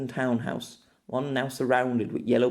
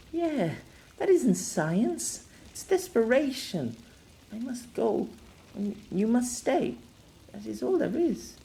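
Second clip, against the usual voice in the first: pained, resigned woman; 11 semitones higher than usual. Acted. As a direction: deliver a sorrowful farewell in a high voice.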